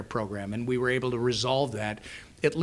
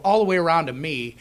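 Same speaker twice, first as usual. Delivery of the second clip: ordinary talk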